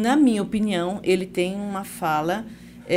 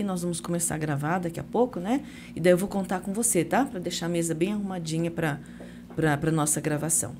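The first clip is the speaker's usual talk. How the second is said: softer, lower, considerate explanation; gentle request; 4 semitones lower, 7 dB quieter than usual. Shown as a demonstration. Asking a colleague to tidy the table before recording, gentle. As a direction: coax politely, keeping it soft. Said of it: "faz uma gentileza pra mim".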